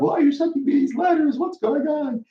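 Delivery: high, mock-upset lawyer; lightly voiced irate lawyer. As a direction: whine it like a rattled lawyer. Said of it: "the lawyer will call me all upset".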